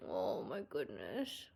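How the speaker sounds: deep, hushed slow "Oh my goodness"; low, soft drawn coo. Real, not acted. Pet sitter hugging the cat, cooing low.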